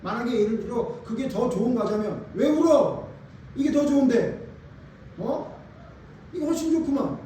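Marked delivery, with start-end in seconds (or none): 2.3-7.2 put-on bright, higher voice cheering up a crying child; cheerful parent. Parent cheering up a crying child, higher and upbeat. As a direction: brush off the tears with an upbeat voice. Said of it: "이렇게 말했을 거 아니에요".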